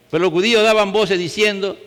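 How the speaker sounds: normal talking voice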